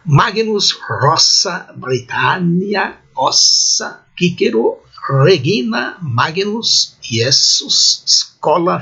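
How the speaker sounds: slow word-by-word Latin model reading; classical Latin pronunciation. Shown as a demonstration. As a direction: sound out each Latin word slowly and separately. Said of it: "em resumo".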